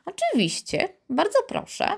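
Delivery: very high, airy reply; amused. A narrator's reading. Karolcia accepting Piotr's challenge, amused and high. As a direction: agree lightly, much amused. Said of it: "Karolcia roześmiała się".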